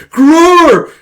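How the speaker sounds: high, very loud mock roar; battle roar. Psychopathic brother lunging forward, battle roar. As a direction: roar as you lunge to attack.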